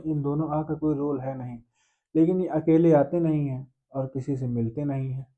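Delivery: usual speaking voice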